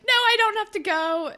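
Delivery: high, eager reassurance. Retold or imitated. Allie telling Johnny she can stay, high and eager. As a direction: gush that you can stay.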